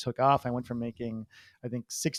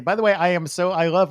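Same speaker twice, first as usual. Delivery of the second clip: normal talking voice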